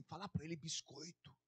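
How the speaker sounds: God murmuring into his ear. Acted. Deus fala assim.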